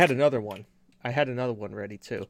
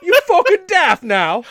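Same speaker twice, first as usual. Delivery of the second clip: much higher, louder, cartoonish British accent; bad British accent; 11 dB louder than usual. Mocking a T-Rex-like bloke for cohost, bad British accent. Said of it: "the worst accent possible".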